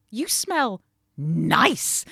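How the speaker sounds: slow, drawn-out, weirdly loud compliment; disgusted quote. Retold or imitated. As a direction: stretch it out like it's a strange thing to say.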